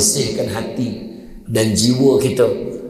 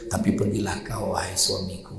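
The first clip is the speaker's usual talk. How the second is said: soft gentle wife; 5 semitones lower, 8 dB quieter than usual. Retold or imitated. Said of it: "Aisyah kata apa".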